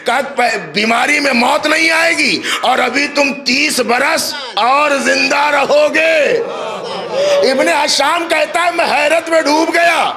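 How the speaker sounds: soar and hold the words like a majlis orator; preaching at full force. Acted. Preacher to the majlis audience, raising the story to a sung climax.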